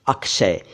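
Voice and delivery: अक्षय said as "ak-shay"; correct क्ष sound. Shown as a demonstration. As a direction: clear k plus sh in अक्षय. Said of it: "अक्षय नहीं".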